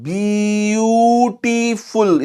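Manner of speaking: ब्यूटीफुल stretched extremely slowly, vowels held long; ब्यूटीफुल in three long, separated syllables; syllable-by-syllable demo. Shown as a demonstration. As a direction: draw out ब्यूटीफुल sound by sound for counting. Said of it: "इसमें दो से ज्यादा sound आ रहे हैं".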